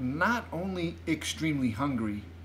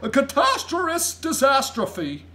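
loud, high, spluttering giant; big aghast outburst; giant crying out; 12 semitones higher, 6 dB louder than usual. A narrator's reading. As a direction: declare it a total calamity. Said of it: "cried the B F G".

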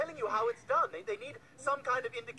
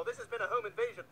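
ordinary delivery

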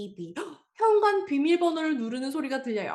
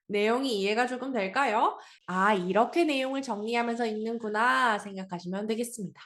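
usual speaking voice

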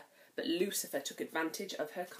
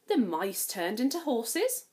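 usual speaking voice

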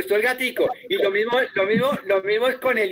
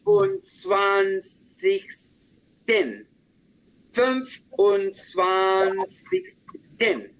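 very slow, each syllable drawn out; slow demo; much slower than usual. Shown as a demonstration. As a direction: spell it out slowly for the student. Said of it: "te lo repito dos veces bien, bien despacito". (muy despacio, sílaba por sílaba)

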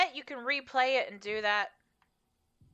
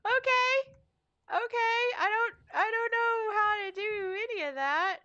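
whining; 11 semitones higher, 4 dB louder than usual. Pretend. Whiny fake cry.

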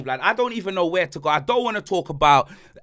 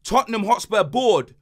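ordinary talk

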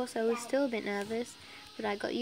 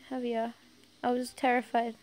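plain reading voice

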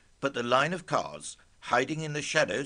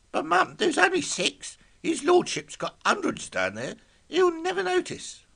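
put-on high, louder young lad's voice; 5 dB louder than usual. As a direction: talk back to Mum as a high-voiced lad. Son Colin voiced in the story.

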